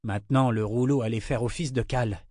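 plain reading voice

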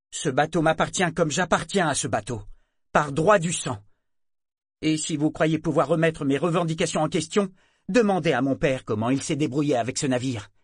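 déclaré fort, plus aigu; revendication fière. Acted. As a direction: proclame son droit avec fierté. Yémin revendique le navire. (revendication fière)

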